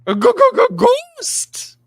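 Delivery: very high, loud panicked stammer; badly faked Shaggy spotting a ghost. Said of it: "That was bad".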